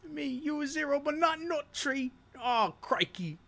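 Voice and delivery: high, hammed-up Aussie accent; goofy Australian greeting to a friend; mock Australian accent. Greeting a viewer in a jokey put-on Australian accent. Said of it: "Dude, I'm Australian for now".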